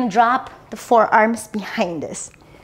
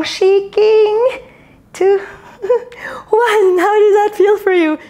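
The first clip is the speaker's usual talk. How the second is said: excited strained shout; 8 semitones higher, 4 dB louder, slower than usual. Real, not acted. Straining through the last count.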